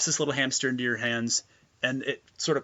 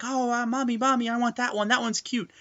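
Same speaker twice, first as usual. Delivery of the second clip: put-on child voice; 8 semitones higher than usual. GM voices kids in store.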